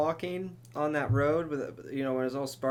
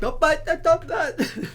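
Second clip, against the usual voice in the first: high, loud, silly cartoon-minion gibberish; 10 dB louder, slower than usual. Teasing a tripping friend in a high, loud minion voice. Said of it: "Talking like fucking minions".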